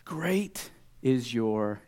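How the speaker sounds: very slow, weighted words; deliberate, drawn-out marvelling; awed praise. Acted. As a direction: space each word out in wonder. Jesus marvels, slow build-down.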